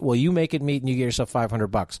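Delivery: ordinary talk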